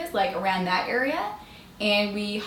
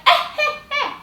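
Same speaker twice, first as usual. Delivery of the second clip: very high laughing eh-eh; mock a lady cracking up; cackling lady; 4 dB louder, faster than usual. Lady in the cab laughing at her, very high.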